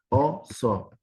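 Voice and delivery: "Oso" split into o - so, with a gap between; syllable by syllable. Shown as a demonstration. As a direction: segment "Oso" into two separate syllables. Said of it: "la segmentación por ahí está más tratada en el hecho silábico".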